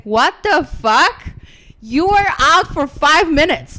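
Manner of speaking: loud, high disbelief; shocked outburst. Retold or imitated. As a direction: yell it in stunned disbelief. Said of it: "he was like".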